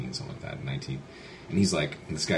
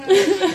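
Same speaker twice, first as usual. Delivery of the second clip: laugh; 8 dB louder than usual. Real, not acted. Teller laughing after imitation.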